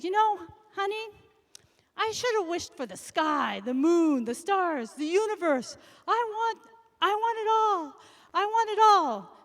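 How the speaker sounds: spoiled wife demanding the universe; greedy demand. Acted. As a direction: build to a greedy loud cry of I want it all.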